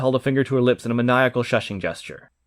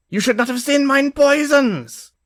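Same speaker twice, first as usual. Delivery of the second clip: put-on barked German accent; 13 semitones higher than usual. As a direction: bark it out with a thick German accent. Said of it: "She had a densely thick Germanian accent".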